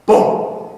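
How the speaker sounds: one loud, abrupt boom; imitated landing thud. Replays the landing.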